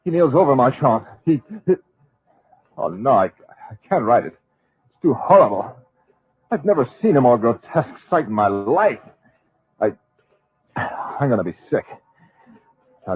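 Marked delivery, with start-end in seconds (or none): 0.1-11.8 louder, higher, stammering horror; horrified. Acted. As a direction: recoil from what you see. Narrator horrified by the sight.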